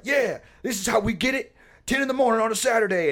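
lightly voiced fitness influencer; gym bro voice. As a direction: brag like a pumped-up gym guy. Imitating the fitness guy.

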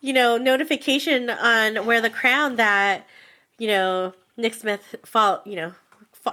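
ordinary delivery

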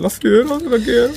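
high, swooning fan voice; gushing fan. Retold or imitated. Fans leaving comments for Fiera, gushing adoration. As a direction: coo it like a lovestruck admirer. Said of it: "fans que te dicen".

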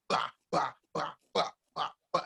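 short "bah" bursts with gaps between; punchy mouth-made blast noises; imitated finger-snap blasts. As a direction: imitate a rapid series of fiery snaps. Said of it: "going through the snapping phase".

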